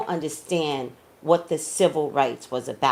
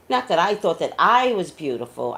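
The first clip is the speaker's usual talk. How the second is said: ordinary talk, nothing marked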